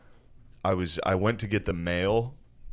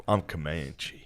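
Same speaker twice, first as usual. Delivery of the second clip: cowboy drawl; 6 semitones lower, 8 dB quieter, slower than usual. Retold or imitated. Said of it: "He's been talking like a cowboy".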